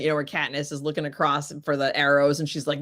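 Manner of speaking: ordinary talk, nothing marked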